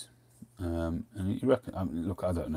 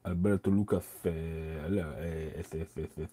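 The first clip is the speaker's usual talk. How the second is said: Spanish accent with a lisped name; slower than usual. Shown as a demonstration. Saying the Spanish roller's name with a put-on Spanish lisp. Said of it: "get my lisp on".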